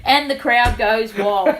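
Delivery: ordinary delivery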